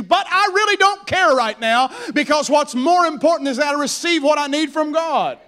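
loud, high preaching shout; desperate determination. Acted. Woman in the crowd refusing to give up, determined.

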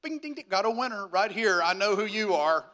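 loud, playful jackpot call; mock winner announcement. Preacher reacting to meeting Appalachian mountain people, loud mock jackpot call. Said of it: "and I go".

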